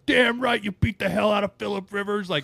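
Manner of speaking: high, loud fan yell; young Broncos fan cheering in an old post, high and loud; fan shouting. Retold or imitated. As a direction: hype it up like a diehard fan. Quoting his old Facebook post.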